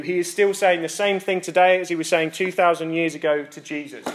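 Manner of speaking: ordinary delivery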